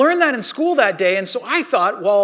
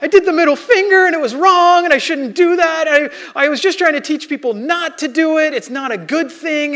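tearful pleading; 8 semitones higher, 6 dB louder than usual. Retold or imitated. Crying child confessing to mom.

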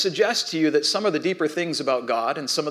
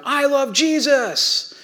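imitated kid's shout; 10 semitones higher, much slower than usual. One kid one-upping the room, mock shout. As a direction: call it out slowly like an excited child. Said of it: "he shouts from one side of the room".

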